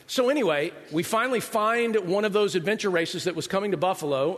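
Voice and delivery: ordinary talk, nothing marked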